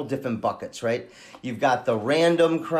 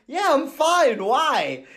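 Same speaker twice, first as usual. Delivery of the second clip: puzzled; 12 semitones higher, much slower than usual. Retold or imitated. His puzzled reply to the greeting.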